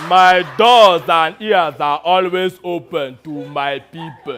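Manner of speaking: loud, slow, hammed-up African warlord. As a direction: boom it out like a pompous militia general. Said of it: "in his best impression of an African warlord".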